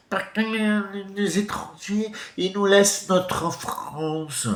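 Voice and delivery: high, drawling caricature; slow, high put-on voice of a pompous nationalist; mocking politician impression. Speaker mimics a politician complaining about foreigners, mocking. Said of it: "on t'a entendu ta voix?".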